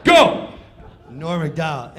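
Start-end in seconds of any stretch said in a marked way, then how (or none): 0.1-0.5 much higher, louder yell; cue shout. Retold or imitated. Stage manager yelling the go cue. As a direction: fire the go cue.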